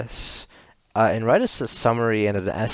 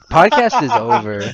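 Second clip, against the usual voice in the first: mock outburst; 12 semitones higher, 9 dB louder than usual. Mock storming off podcast.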